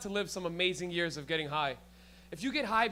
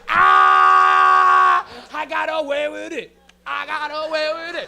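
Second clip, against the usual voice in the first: high, loud holler of triumph; crowing at full volume, imitating an audience member; gleeful mock shout; 7 semitones higher, 17 dB louder, slower than usual. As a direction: shout it like you beat the system. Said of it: "your face was so funny. You're like".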